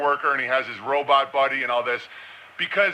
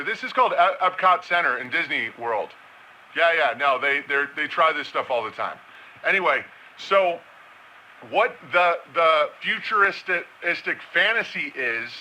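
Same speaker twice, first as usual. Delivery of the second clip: ordinary delivery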